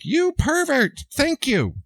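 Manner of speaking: high mocking yell at a catcaller; mock crazy woman. Mocking the screaming mother on the street, shrill parody. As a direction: parody her screaming, pitch jumping up.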